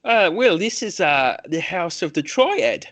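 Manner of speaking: high-pitched, hesitant temple priest; temple host. Acted. Priest introduces the House of the Triad to visitors, high and hesitant.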